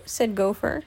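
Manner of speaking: plain reading voice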